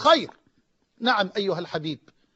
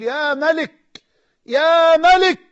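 a rising, very loud high call to God; crying out; 6 semitones higher, 7 dB louder, much slower than usual. Retold or imitated. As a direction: call out to the King, swelling. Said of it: "فظللت أصرخ وأقول". (صرخة استغاثة عالية)

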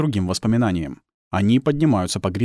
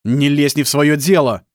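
loud, higher-pitched rebuff; angry retort; 5 semitones higher, 7 dB louder than usual. Acted. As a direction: tell her off loudly. Husband snaps back at wife.

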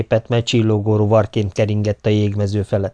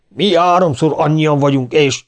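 mild enraged yell, raised pitch; 7 semitones higher, 4 dB louder than usual. A narrator's reading. Stoker threatening the outnumbered officers, moderately enraged. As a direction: threaten angrily in a higher voice. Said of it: "ordította bőszülten".

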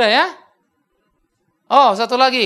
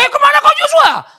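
very loud, high Batak holler; shouted Medan Batak greeting; Batak speech; 12 dB louder than usual. Shown as a demonstration. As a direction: banter that sounds like a Batak brawl. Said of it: "orang Batak ngomong, antara bercanda sama berkelahi bingung".

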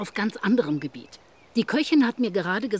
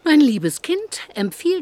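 mock-indulgent exclamation; 10 semitones higher, 8 dB louder, slower than usual. Sister mock-chides Mary.

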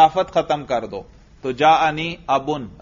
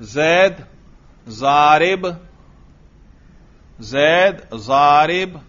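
slow dictation; much slower than usual. Shown as a demonstration. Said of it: "اگلا جملہ لکھیے بھائی".

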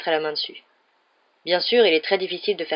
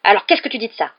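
loud, raised demand; indignant challenge; 4 semitones higher, 8 dB louder, faster than usual. A narrator's reading. Wife demanding her husband's reaction, indignant. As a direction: challenge him to answer. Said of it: "s'exclama madame Weasley".

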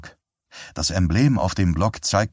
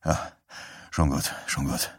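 tired soft resignation; 5 semitones lower, 8 dB quieter than usual. Acted. Herb wearily ends call.

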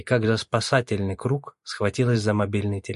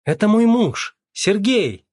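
high, loud panicked cry; 12 semitones higher, 7 dB louder, slower than usual. A narrator's reading. Mother startled by the front door, crying out. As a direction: cry out at a sharply raised pitch. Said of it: "а женщина закричала".

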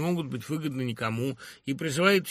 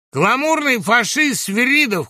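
high, loud, slow heckle; brand him publicly at full voice; denouncing shout; 11 semitones higher, 8 dB louder, slower than usual. A narrator's reading. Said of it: "крикнул Гусев". (обличительный крик)

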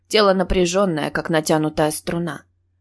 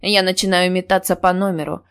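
ordinary delivery

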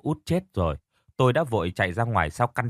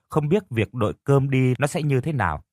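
ordinary delivery

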